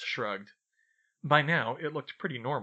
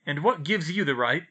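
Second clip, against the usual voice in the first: growled; 4 semitones higher, 6 dB louder than usual. A narrator's reading. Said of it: "Vernon Dursley growled".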